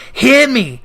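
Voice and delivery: loud, high commanding cry; ghost cries out. Acted. Marley's ghost crying out at the dismayed Scrooge, loud and high. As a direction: cry out to seize his attention. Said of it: "cried the ghost".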